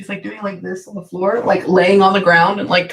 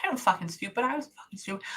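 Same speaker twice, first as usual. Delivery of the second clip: much softer, under-the-breath quote; hushed self-mockery; 12 dB quieter, faster than usual. Medium relays a departed friend laughing at herself, hushed. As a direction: relay her admission quietly, trailing softer. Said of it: "she's laughing. She goes".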